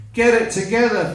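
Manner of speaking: high, loud, rapid command; exasperated shout. Retold or imitated. Girlfriend ordering boyfriend to recover, exasperated shout. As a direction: yell the order quickly, patience gone. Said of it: "I've been shouted at by two people".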